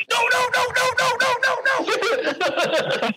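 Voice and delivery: a hammed-up stage-whisper panic; mock panicked no's. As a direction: squeal it in a panic, laughing. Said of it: "in his whispering voice".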